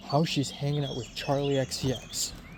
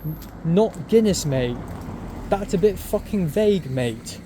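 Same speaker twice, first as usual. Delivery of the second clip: mocking British rebuke, louder and higher; British bartender; 4 semitones higher, 7 dB louder than usual. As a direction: scold the customer like a cheeky British barman. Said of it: "this British guy".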